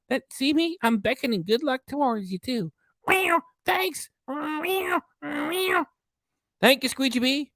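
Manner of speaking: high cat puppet voice; lucky cat voice. Pretend. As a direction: squeak like a beckoning cat. Cat voice continues.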